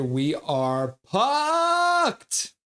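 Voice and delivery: high, hugely stretched last word; drawn-out punchline. Acted. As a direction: drag out the final word for comic doom. Reads a doom message, stretching punchline.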